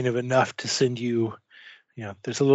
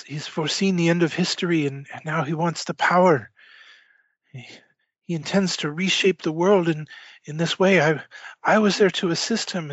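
higher-pitched, halting urgent character voice; frantic informant; 4 semitones higher, faster than usual. Acted. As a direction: blurt out the plot in broken bursts. Man confides plot before being shot.

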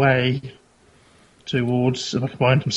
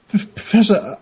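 normal talking voice

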